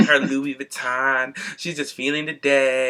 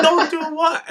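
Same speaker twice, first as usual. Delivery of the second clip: put-on much higher, baffled voice for the older coworker; mock confused older coworker; 12 semitones higher, 4 dB louder, slower than usual. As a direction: ham up the clueless older man who doesn't get the slang. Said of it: "He's like".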